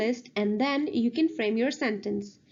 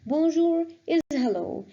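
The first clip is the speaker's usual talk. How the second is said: ordinary talk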